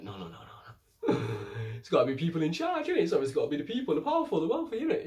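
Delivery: hammed-up sneering aristocrats; mocking snobbish lords. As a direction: scoff at the idea like arrogant nobles. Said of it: "everyone starts laughing at him like".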